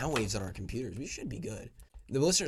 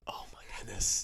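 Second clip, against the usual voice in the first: whispered laugh; 5 semitones lower, 7 dB quieter, slower than usual. Real, not acted.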